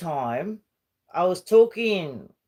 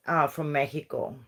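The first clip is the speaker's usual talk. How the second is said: ordinary talk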